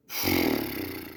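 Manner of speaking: long, low, heavy exhale; low drawn-out sigh of disbelief; exasperated sigh. Real, not acted. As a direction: let out a big weary sigh. Reacting to long ancestry list.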